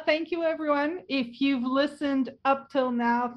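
ordinary talk, nothing marked

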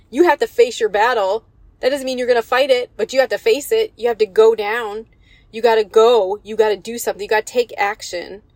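rising preacher's call to action; preaching at full force. Acted. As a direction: drive the point home to the church. Preacher exhorts the congregation.

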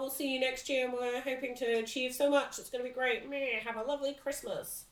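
lightly voiced bored boss; hint of a droning boss speech. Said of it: "the boss gives a big speech that's just like".

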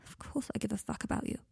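hushed, near-whispered reassurance; small, quiet protest. A narrator's reading. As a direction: say it very softly, almost under the breath. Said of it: "said Draco quietly".

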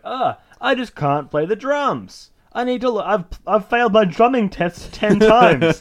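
high, slow whining complaint; whiny drummer impression. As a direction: whine it like a hopeless student. Mock whiny [name] quote.

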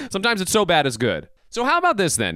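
ordinary delivery

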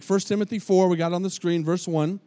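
ordinary talk

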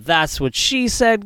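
slow, high doofus delivery; catchphrase guy voice. Retold or imitated. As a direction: drag out the catchphrase like a goof.

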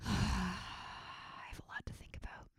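breathy whisper to the mic. Acted. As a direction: whisper it as a private thought. Whispered musing.

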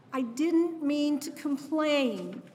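the last aching take of the apology, drawn out; brokenhearted plea. Retold or imitated. Mother finishing her mantra to her lost baby, slow and aching. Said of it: "My brokenhearted mantra".

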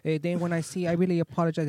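put-on Chinese-like accent. Apologizing to angry driver, mock Chinese accent. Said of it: "that sounded like a Chinese accent".

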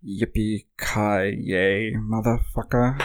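slow, drawn-out British villain impression; measured delivery, stretching every word out; Alan Rickman. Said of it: "can each of us do an Alan Rickman".

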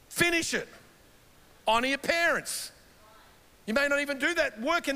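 preaching exhortation. Real, not acted.

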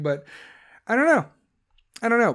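ordinary delivery

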